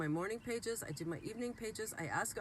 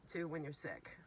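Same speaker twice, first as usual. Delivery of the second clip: usual speaking voice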